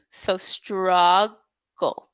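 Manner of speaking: "struggle" stretched very slowly, straw then g; teacher slows "struggle" for the student. Shown as a demonstration. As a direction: drawl "struggle" out syllable by syllable. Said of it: "and then you get that g".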